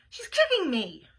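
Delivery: mildly loud protest to mom; acted kid's complaint; tattling complaint. Boy tattles on his sister.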